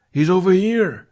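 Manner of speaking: high, loud call to the other guards; guard shouting. Acted. Guard alerting the others to Hiccup, loud call. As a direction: holler so the whole street hears.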